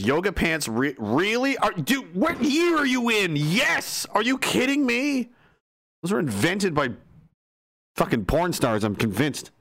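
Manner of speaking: high, slow, outraged mom; indignant mom voice. Retold or imitated. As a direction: read it like a scandalised mother. Reading a chat message in character.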